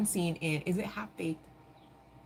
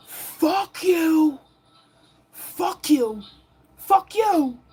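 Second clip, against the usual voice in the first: much higher, much louder mock yell of a fed-up worker; retold yelling; 10 semitones higher, 9 dB louder, much slower than usual. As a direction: bellow it over the PA like you're quitting. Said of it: "and he's like".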